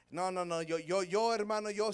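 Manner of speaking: ordinary delivery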